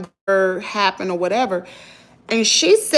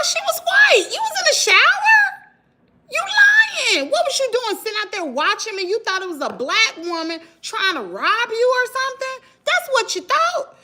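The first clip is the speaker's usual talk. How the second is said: higher, shouting through laughter and a near cry; outraged mocking callout; 13 semitones higher than usual. Real, not acted. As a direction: accuse her, half laughing half wailing. Confronting neighbour, laughing, shouting.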